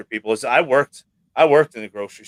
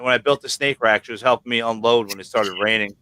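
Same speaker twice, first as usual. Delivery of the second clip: ordinary delivery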